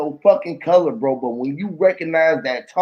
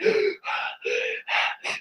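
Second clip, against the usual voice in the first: drooling gasp; 9 dB quieter than usual. Retold or imitated. Brothers drooling over woman.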